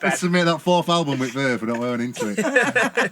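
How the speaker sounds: mock rock star. Mock [name] fantasy.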